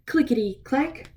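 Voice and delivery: usual speaking voice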